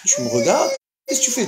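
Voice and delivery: high, squeaky put-on voice; mock accusing stranger, pitched high; mock suspicious stranger. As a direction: play the nosy guy staring back. Acted quote of a stranger.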